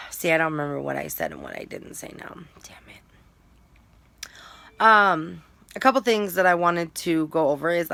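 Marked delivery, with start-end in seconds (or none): 0.1-4.3 muttered whisper to herself. Real, not acted. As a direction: whisper it under your breath.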